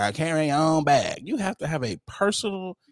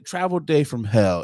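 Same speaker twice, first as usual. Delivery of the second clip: ordinary talk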